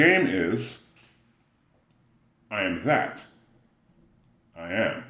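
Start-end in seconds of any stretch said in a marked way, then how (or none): 2.5-5.0 "I am that", held pause, then "I am"; comma after THAT. Shown as a demonstration. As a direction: lift on "that", wait, then settle on "I am". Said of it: "The comma actually goes after that".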